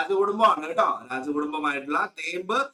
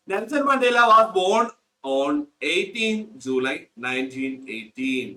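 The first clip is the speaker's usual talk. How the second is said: slow dictation; much slower than usual. Shown as a demonstration. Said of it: "slow dictation reading".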